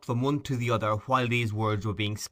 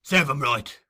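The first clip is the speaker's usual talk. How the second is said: louder harsh retort; 4 semitones higher, 6 dB louder than usual. Acted. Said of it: "cried Sikes".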